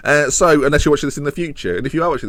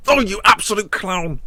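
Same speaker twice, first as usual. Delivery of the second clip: higher, mocking exasperation at a miss; exasperated jeer; 8 semitones higher than usual. Real, not acted. Manager jeering his striker for a miss, higher exasperated jeer. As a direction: groan it at the player like he's a clown. Player misses, manager mocks.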